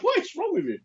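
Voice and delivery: high-pitched incredulous complaint; mock exasperation. Husband to swooning wife, mock exasperated. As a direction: pitch shoots up, baffled by the partner.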